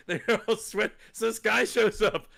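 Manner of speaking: laughing through the words. Real, not acted. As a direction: giggle while saying it. Laugh heard while telling.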